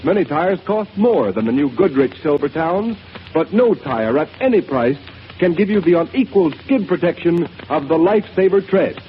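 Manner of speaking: ordinary talk, nothing marked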